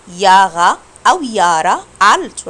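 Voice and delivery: ياغا then يارا, both slow; slow demo of يارا with a y sound; wrong then right Jahre. Shown as a demonstration. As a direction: contrast the mistake ياغا with the correct يارا, slowly. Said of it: "اسمها جارة، لا".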